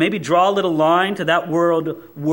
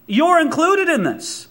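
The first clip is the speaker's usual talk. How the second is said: ordinary talk, nothing marked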